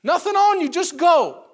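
rising to a loud, high release; joyful blessing. Acted. Elisha sending the healed Naaman away, loud joyful blessing. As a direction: build up and send him off triumphantly. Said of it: "He says".

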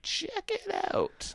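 high, slow, hushed check it out; breathy awestruck whisper; mock impressed voice. Mock voice for big penis reaction.

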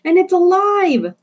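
very high, slow, loud cry of horror; horrified shout. Acted. A bystander shrieks that the thing is alive, very high and slow. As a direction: shriek it out in disbelief. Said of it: "another person shouted".

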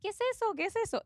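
high, lightly voiced puzzled asker; mock curious asking. Mocking people who ask about her shell picture, high and puzzled. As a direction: pitch it up like a puzzled visitor.

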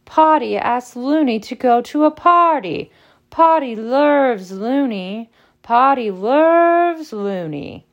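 high, loud mocking chant; shrieking taunt. A narrator's reading. Tormentor teasing Potty about loving Loony, shrieking taunt. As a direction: stretch it out in a loud, teasing singsong. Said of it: "cackling and shrieking".

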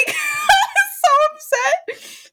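high, slow wailing laugh; cry-laugh the upset out; laughing exasperation. Real, not acted. Telling friends about the short date, wailing with laughter.

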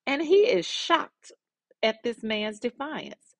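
slow, high shocked telling; storyteller acting stunned. A narrator's reading. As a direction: draw out the shock, pitch up high. Narrating David's shock.

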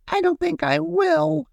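very high, tiny whimpering character voice; storybook sob in a little squeak; sobbing. A narrator's reading. Little paintbrush doubts himself to the broom, mock sobbing. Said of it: "sobbed the little paintbrush".

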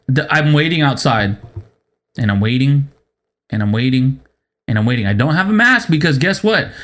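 ordinary talk, nothing marked

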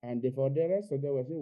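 ordinary talk

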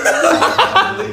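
very high, slow, laughing; laughing quote. Retold or imitated. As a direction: squeal it through laughter.

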